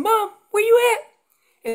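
high, loud, drawn-out call for Mom; strayed calf calling. Acted. Calf looking for Mom, high loud slow call. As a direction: holler slowly up high for a parent. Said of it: "That's that calf saying".

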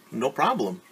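plain reading voice

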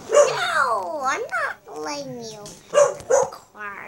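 sudden high shout, slowing right down; a loud, slow, drawn-out no; loud refusal. Real, not acted. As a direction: yell no and drag out the refusal.

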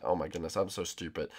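normal talking voice

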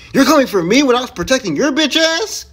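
high, loud incredulous challenge; indignant outburst. Real, not acted. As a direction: demand an answer, voice jumping up. Indignant at teammate attacking him.

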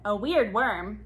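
plain reading voice

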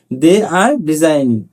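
slow, spaced-out drill sentences. Shown as a demonstration. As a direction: drill each word slowly for the class. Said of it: "teacher drills example". (ধীরে ধীরে অনুশীলন বাক্য)

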